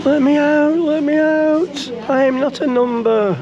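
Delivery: high, pleading cry to be freed; desperate shout. Pretend. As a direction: yell it like a trapped prisoner. Quoting The Prisoner, pleading.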